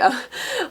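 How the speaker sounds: quick rising giggle; a short real giggle; bright giggle. Real, not acted. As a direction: laugh up high at the joke. Laughing at fake boyfriend scene.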